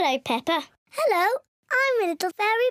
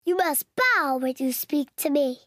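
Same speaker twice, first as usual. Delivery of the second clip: ordinary talk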